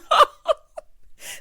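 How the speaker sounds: high burst of laughter; loud cackle. Real, not acted. Host cracking up at the hairstyle joke, cackling.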